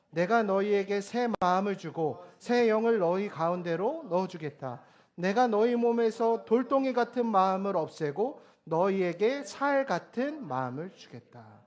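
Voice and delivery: slow, loud, measured recitation; scripture reading. Real, not acted. Preacher leading the congregation through the verse, solemn recitation. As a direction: lead a congregation reading in unison.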